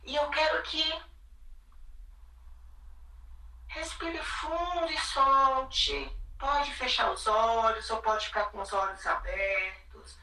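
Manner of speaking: calm, hushed, sinking into quiet; soothing spa whisper. Acted. Leads a guided body meditation.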